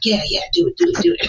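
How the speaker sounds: high rising devil's whisper egging her on; a sly little devil voice urging do it; mock devil cheering her on. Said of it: "the devil's over there like".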